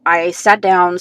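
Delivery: usual speaking voice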